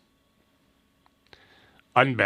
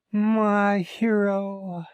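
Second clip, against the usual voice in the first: very slow, high, adoring sigh; swooning damsel; 9 semitones higher, much slower than usual. Acted. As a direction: swoon over the rescuer. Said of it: "the damsel in distress".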